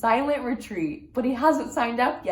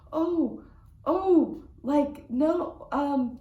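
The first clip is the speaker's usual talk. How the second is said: flustered, higher stammer; caught off guard; 6 semitones higher, much slower than usual. Retold or imitated. Caught off guard by the woman's question, flustered and higher. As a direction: fumble the words, surprised.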